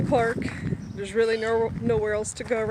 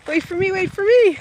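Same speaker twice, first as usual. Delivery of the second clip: high, loud call to someone ahead; 9 semitones higher, 6 dB louder than usual. Real, not acted. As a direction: shout after him to wait up. Calling after Terry running ahead.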